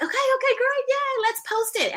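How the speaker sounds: very high, gleeful rush; high-pitched retold cheering; excited friend. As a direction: imitate a friend thrilled to post it. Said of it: "they're all excited".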